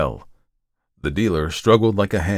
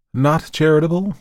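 higher-pitched, gloomy question; 10 semitones higher, 4 dB louder than usual. A narrator's reading. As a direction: echo it back gloomily. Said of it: "returned the other gloomily".

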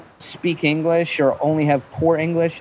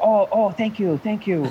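higher-pitched imitation Japanese accent; 6 semitones higher than usual. Host greeting Japanese businessmen, higher mock Japanese accent. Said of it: "if I bring some Japanese".

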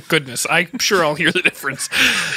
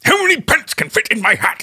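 badly faked posh English; posh British accent; 3 semitones higher than usual. Student repeats the software's line, hammed-up posh British accent. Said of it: "That's posh".

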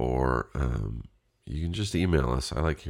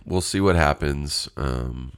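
ordinary talk

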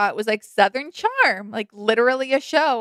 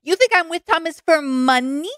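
higher, mocking sass; sassy impression; 6 semitones higher than usual. Imitating Catherine mocking the gold-digger claim with sass. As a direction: toss it back at him, scornful. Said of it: "do the impression of".

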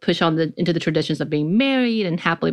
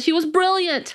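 put-on higher-pitched British accent on brilliant; mock British accent; 12 semitones higher than usual. Host gushing about the film to listeners, in a mock British accent. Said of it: "my bad accent".

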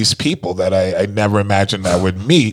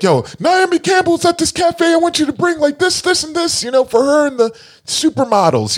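boss giving orders. Retold or imitated. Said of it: "he'd be like".

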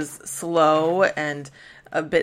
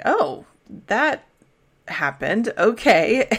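high, startled "oh", then a flat "okay"; awkward surprise; 6 semitones higher, 4 dB louder, slower than usual. Retold or imitated. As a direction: sound caught off guard, a little amused. Everybody reacts to surprise song.

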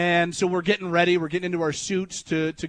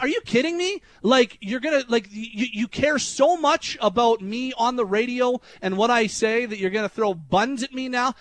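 much higher, pushed, exasperated rant; angry disbelief; 6 semitones higher than usual. Retold or imitated. Host retelling confronting the drunk dad at the reception, angry disbelief. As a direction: replay the fury at the bun thrower. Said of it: "I got really mad at him".

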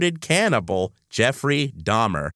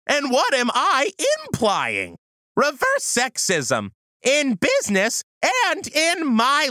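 indignant, emphatic swoops in pitch; comedic review rant; 13 semitones higher than usual. Acted. Host reviews reverse sexism for listeners, mock-indignant. Performs a ranting review segment.

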